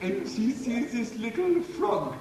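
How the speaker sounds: storyteller hamming up a lightly put-on Indian accent; mock Indian accent. Musician joking to his bandmate, mock Indian storyteller accent. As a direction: spin the tale in a playful mock Indian lilt.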